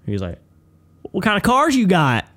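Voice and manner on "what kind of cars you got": high, loud mock interrogation; confrontational coach. Coach keeps questioning a student about toys, confrontational. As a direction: grill the kid with a raised, pushy voice. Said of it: "starts laying into this kid".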